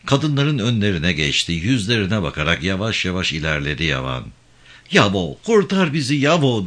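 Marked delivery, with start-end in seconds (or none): 4.9-6.7 restrained, higher-pitched plea shouted by women; narrated cry for help. A narrator's reading. Women begging Yavan to save them, restrained calling out. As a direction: call out high, without a full shout. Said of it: "diye bağırıyordu bazı kadınlar".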